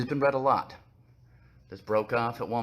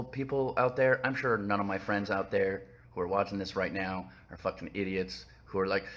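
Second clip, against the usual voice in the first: ordinary talk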